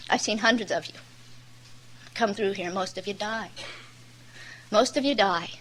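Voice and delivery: low, soft, grave counsel; imitating a quiet counselor, sober and unhurried; gentle and quiet. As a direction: drop the voice and advise gently. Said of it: "really quiet with me".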